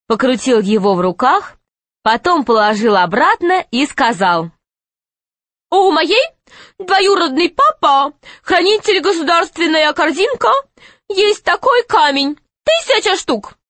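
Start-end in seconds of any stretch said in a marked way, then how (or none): 5.7-13.6 put-on high boy's voice; raised pitch young boy. Pashka boasting to M.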